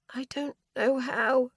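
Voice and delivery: forcing words out through pain; tried to say. A narrator's reading. Injured Aoife struggling to answer, strained. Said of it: "I tried to say".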